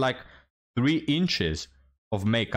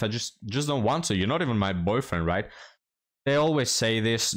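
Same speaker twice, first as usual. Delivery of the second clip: ordinary talk, nothing marked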